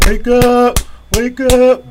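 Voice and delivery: barking recruits out of bed; drill sergeant yell. Retold or imitated. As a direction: bellow it like a drill sergeant at dawn.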